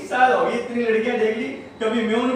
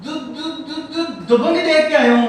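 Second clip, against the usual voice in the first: put-on stuttering boy; 5 semitones higher than usual. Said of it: "तुतला के बोलता है".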